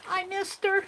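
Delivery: pitched well up, a little pleading; wistful high repeat. Real, not acted. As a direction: lift it high like a sad sigh. Repeats missing Aunt Beck.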